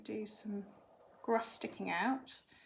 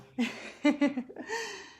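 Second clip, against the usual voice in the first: bright laugh; 7 semitones higher, 5 dB louder than usual. Real, not acted. Reacting to the children.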